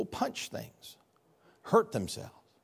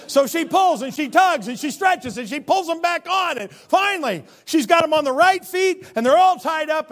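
high, loud exasperated telling; energetic comic narration; animated struggle; 12 semitones higher, 9 dB louder than usual. A narrator's reading. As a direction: build the tugging. Tells the struggle with boots.

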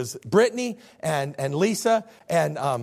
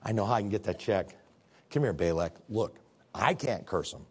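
low, softer, confiding voice; scheming Balaam; 9 semitones lower, 5 dB quieter than usual. Acted. Balaam taking Balak aside with a plan, low confiding tone. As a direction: draw the listener in conspiratorially. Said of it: "And Balaam says".

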